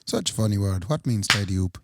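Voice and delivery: bad Swedish accent, pitched low; a clumsy Swedish accent, dropped low. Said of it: "It was supposed to be a Swedish accent".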